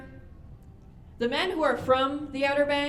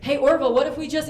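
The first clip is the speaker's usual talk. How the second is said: louder, eager pitch to Orville; eager brother; 4 semitones higher, 8 dB louder, faster than usual. Retold or imitated. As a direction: blurt it out, excited. Wilbur pitching idea loudly.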